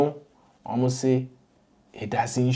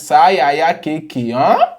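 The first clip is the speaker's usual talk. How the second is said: mock London accent; 5 semitones higher, 10 dB louder than usual. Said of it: "Mister P says".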